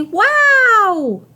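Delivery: high, drawn-out "wow"; birthday-present amazement, long and high; amazed wow. Acted. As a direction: stretch out a delighted, high-pitched wow. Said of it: "your speech bubble might say".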